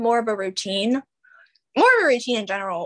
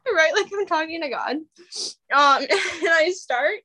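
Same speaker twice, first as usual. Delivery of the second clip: higher voice, giggles breaking in; laughing; 6 semitones higher than usual. Real, not acted. Host resuming talk of journaling to God, still giggling. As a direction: try to carry on through leftover laughter. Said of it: "I don't know why I'm laughing".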